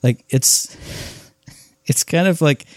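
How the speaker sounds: ordinary talk, nothing marked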